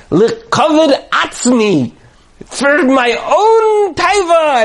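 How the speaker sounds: high, slow, stretched-out declaiming; gleeful self-indulgence. Retold or imitated. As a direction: proclaim it grandly, drawing out the vowels. Rebbe mock-solemnly toasts his appetite.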